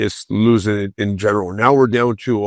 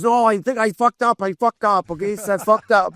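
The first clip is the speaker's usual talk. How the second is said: coach admitting fault; 9 semitones higher than usual. Retold or imitated. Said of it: "He goes".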